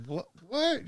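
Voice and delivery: sharp high disbelieving what; girl doubting the fake town; incredulous what. Girl's incredulous reply quoted.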